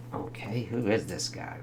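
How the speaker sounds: ordinary delivery, nothing marked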